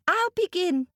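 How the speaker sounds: a tiny creature speaking up; small voice. Acted. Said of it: "said the Mole".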